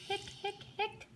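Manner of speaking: high, fast hiccupping hics; hiccup sounds. Pretend. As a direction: squeak out the hiccups. Parent voices skeleton hiccups.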